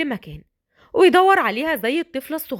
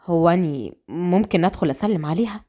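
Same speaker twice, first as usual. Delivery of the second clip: low, polite request; politely asking permission; respectful; 6 semitones lower than usual. Acted. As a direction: ask leave deferentially in a lower male voice. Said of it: "باسل باحترام".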